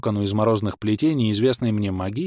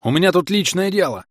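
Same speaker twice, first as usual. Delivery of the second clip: irritated shout; 9 semitones higher, 4 dB louder than usual. A narrator's reading. Hero yelling at enemy.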